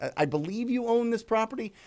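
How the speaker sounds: timid caller stumbling into the opener; hesitant. Shown as a demonstration. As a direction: sound unsure of yourself on the call. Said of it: "if you're kind of like".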